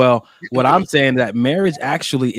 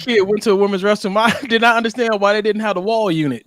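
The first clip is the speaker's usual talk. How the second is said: high, fast, laughing retelling; 9 semitones higher than usual. Real, not acted. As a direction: tell it while still laughing. Laughing retelling of childhood memory.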